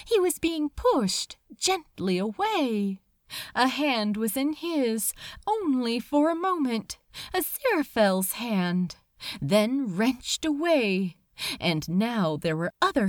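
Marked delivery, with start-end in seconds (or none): none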